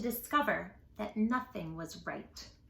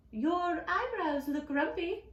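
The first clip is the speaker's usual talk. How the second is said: higher, slower remark; high teasing; 6 semitones higher than usual. Acted. Lemur teasing Jim about his brows, high.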